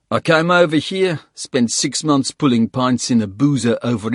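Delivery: put-on sneering ex-soldier, South African accent. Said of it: "South African accent".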